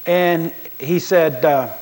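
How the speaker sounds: ordinary delivery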